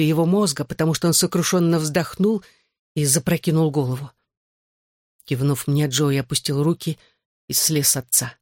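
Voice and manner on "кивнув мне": plain reading voice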